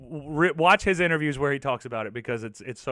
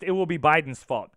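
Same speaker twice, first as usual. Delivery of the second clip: normal talking voice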